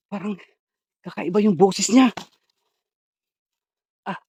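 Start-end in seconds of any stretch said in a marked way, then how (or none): 0.1-2.1 loud, raised-pitch alarm; alarmed exclamation. Real, not acted. Searcher telling viewers after an attack, loudly alarmed. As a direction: blurt it out loudly, still rattled from the attack.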